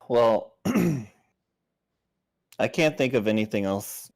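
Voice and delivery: normal talking voice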